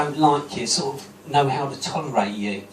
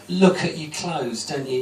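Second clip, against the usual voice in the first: ordinary delivery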